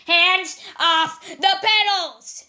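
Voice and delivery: hands-off snarl, slow and loud; angry flower. Acted. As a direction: snap it out as a prickly little flower. Flower snapping at Pearl.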